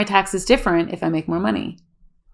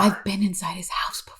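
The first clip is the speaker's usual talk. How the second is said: whispering; 6 dB quieter than usual. Acted. Joins spooky whisper.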